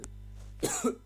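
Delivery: dusty cough; coughing on dust. Real, not acted. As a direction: cough sharply, then recover. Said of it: "j'arrête pas de tousser".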